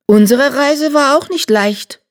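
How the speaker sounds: high, tired she-cat. A narrator's reading. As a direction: sigh out the long journey. Another cat, much higher.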